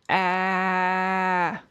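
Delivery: bleating like a goat; a long bleat like a goat. Acted. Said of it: "Do you like my goat impression?".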